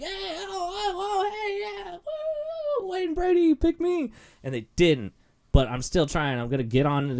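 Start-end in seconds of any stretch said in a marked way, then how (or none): 0.0-4.1 high-pitched cheering and whooping to get picked; game show audience cheer. Retold or imitated. Game show fan cheering for Wayne Brady to pick him. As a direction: yell to the host like an eager audience member. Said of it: "just in the audience, going like".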